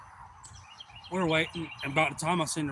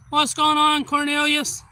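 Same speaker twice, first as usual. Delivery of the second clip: high, loud shout-out to a viewer; real, booming hey-there to someone arriving; loud greeting; 12 semitones higher, 10 dB louder, slower than usual. Real, not acted. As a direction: call out a big hello, pitched way up. Greets a viewer joining the stream.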